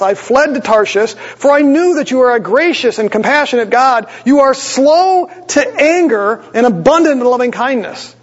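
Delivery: half-mock grumbling to God; pleading Jonah. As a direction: act out a sulky prophet's protest. Jonah's prayer acted out.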